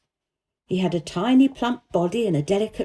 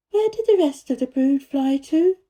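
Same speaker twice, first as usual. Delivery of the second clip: put-on lightly higher girl's voice, earnest; a girl telling the gardener about the secret garden, higher voiced; young Mary voice; 6 semitones higher, 3 dB louder than usual. A narrator's reading. Mary's voice in the story.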